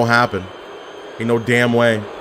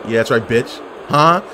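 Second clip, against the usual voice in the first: ordinary delivery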